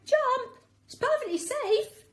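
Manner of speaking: calling up encouragingly. A narrator's reading. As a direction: shout up to the tree. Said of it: "he calls out".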